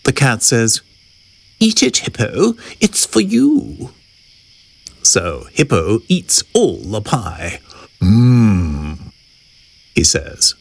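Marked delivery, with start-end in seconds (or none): 1.6-3.9 high, coaxing cat voice; cat character. A narrator's reading. Cat offers hippo the trick pie, high coaxing voice. 8.0-9.1 low, drawn-out mmm; savouring hum. A narrator's reading. Hippo eats all the pie, low savouring hum.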